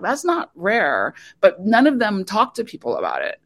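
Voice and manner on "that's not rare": slow emphatic. Retold or imitated. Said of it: "I'm like".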